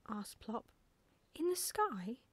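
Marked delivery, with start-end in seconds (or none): 1.3-2.2 high, swooping question; astonished, pitched well up; amazed young owl. A narrator's reading. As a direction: ask in wide-eyed disbelief. Owl amazed at flying deer.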